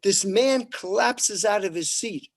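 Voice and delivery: normal talking voice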